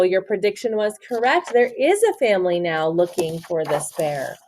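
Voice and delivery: ordinary delivery, nothing marked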